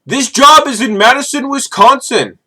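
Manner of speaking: hammed-up Indian accent, pitched high; mock Indian accent. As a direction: put on an Indian recruiter's accent for "Madison, Wisconsin". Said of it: "from Indian recruiters".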